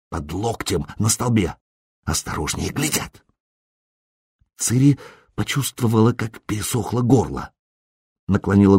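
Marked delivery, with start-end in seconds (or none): 0.1-3.3 very soft, secretive undertone; hushed warning. A narrator's reading. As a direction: warn under your breath so the watchers miss it. Secret orders while captors are watching. (тихое тайное предостережение)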